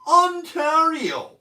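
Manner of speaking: Ontario drawn out slowly, high and loud; triumphant, slow Ontario; finally right Ontario. Shown as a demonstration. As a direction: land Ontario slowly, every syllable stretched. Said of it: "I got there in the end".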